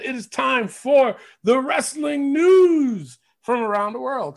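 big drawn-out announcer call. Acted. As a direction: boom it out like a ring announcer. Hosts announcing the news segment.

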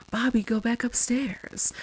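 slow whispered ghost warning; ghostly whisper. Retold or imitated. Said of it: "a different ghost is saying".

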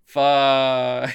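a loud, stunned curse through a laugh; loud, dismayed swear; stunned fuck. Retold or imitated. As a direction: blurt it out, floored. Stunned reaction to nukes scene.

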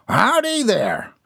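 high, drawn-out country holler; crying out. A narrator's reading. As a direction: call out a howdy from afar. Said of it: "a voice cried from behind the headlights".

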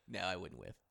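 ordinary delivery

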